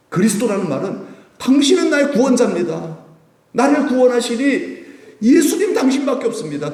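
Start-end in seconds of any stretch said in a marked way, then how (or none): 1.4-6.7 high, loud, laughing declaration; joyful confession. Acted. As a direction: beam out the praise with a laugh. Joyful acted confession to Jesus.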